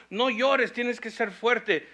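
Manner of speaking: loud, insistent "no llores, tienes que ser fuerte"; well-meaning but pushy comforter; stern urging. Retold or imitated. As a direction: push them to be strong, firm and loud. Quoting people scolding mourners.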